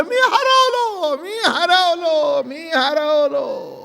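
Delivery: high, long-drawn wail of a lost man; mock wailing cry. Lost husband crying out in the market, wailing. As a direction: stretch each word out in a high, comic howl. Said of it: "ते ओरडू लागला".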